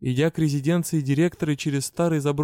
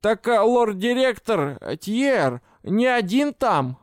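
high, loud call after her; shouted call; 7 semitones higher, 3 dB louder, slower than usual. A narrator's reading. Goblin shouts after the heroine, high and loud. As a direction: shout it after someone walking away. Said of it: "и он крикнул".

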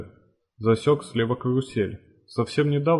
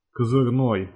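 ordinary delivery, nothing marked

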